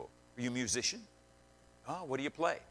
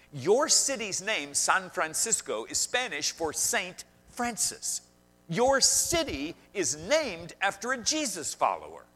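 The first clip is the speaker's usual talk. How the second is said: slow reveal; 8 semitones higher, 6 dB louder, slower than usual. Retold or imitated.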